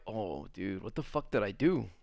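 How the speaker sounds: low, very soft muttered worry; quiet dismay. Retold or imitated. Realizing the girl is mad at him, quiet retold dismay.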